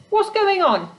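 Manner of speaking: sharp high snap; snapped. A narrator's reading. Startled creature snapping at the girl from the tent, sharp and high. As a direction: bark the question up high. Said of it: "snapped the voice".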